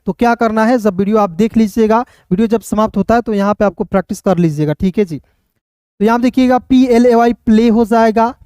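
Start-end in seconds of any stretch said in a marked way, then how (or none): none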